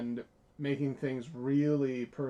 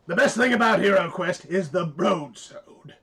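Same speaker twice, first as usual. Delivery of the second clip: loud, higher-pitched character impression; bearded bard; 5 semitones higher, 8 dB louder than usual. Showing the co-host how the bard sounds, loud hammed-up impression. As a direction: proclaim it like an enthusiastic bard, big on the last word. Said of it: "I sounded like the bearded bard".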